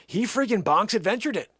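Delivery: ordinary delivery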